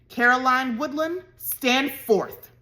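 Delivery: loud commanding call; stern cry. A narrator's reading. Mother calling Caddy forth, loud and stern. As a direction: summon her for punishment. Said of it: "she cried".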